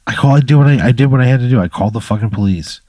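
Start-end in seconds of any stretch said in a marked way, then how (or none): 0.1-1.6 louder and higher, fed up; exasperated outburst. Real, not acted. Player defending the police call to the GM, exasperated and louder. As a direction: snap back defending yourself.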